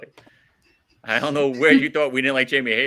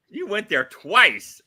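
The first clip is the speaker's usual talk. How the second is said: normal talking voice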